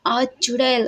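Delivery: usual speaking voice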